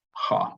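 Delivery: "ha" with a breathed h before the open a; aspirated vowel. Shown as a demonstration. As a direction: demonstrate "ha", breath leading into the vowel. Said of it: "the letter is to be pronounced".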